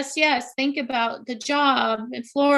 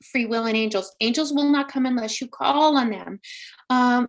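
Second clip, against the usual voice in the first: ordinary delivery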